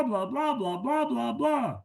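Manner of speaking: mock the droning grown-up; a lightly imitated muffled teacher drone; Charlie Brown teacher drone. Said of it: "Charlie Brown going on with".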